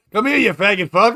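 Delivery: loud teasing jab; mock yell. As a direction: bark it out as a joking insult. Mock insult called out for fun.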